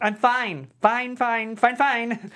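loud, high clipped repeats of fine; insisting fine. Acted. Jordan to Stephanie, loudly insisting he is fine. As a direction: brush her off, insisting. Brushing off principal.

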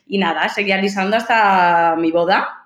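ordinary talk

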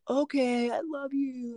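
put-on high childlike voice, softened; little girl. Uncle imitating his niece saying she loves him, high and childlike. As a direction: imitate a small girl making up. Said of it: "and she like".

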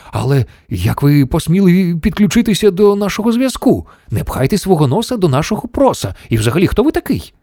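high-pitched affronted demand; indignant. A narrator's reading. Shanks scolds the stranger on the line, indignant. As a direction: tell off the intruder. Astronaut indignant at unknown intruder.

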